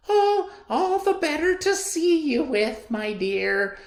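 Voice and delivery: very high, loud mock granny voice; wolf faking a quavery old lady; wolf as old woman. Wolf pretending to be grandmother, very high fake granny voice. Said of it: "trying to sound like an old woman".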